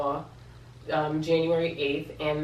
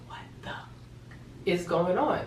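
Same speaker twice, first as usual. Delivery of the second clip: slow, whispered disbelief; stunned half-whisper; 3 dB quieter, much slower than usual. Retold or imitated. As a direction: mouth the first words almost silently, drawn out. Retold stunned reaction to mother.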